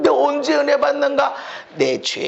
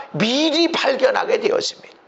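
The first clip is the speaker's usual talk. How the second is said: ordinary delivery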